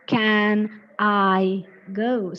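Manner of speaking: very slow, "Can", "I", "go" each stretched apart; word by word. Shown as a demonstration. Teacher showing students the wrong reading, word by word. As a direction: drag out every word of "Can I go" separately. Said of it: "It is not".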